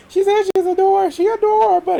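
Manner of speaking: very high, fast mocking. Mocking the adore claim with a very high voice. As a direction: toss it up high in mockery.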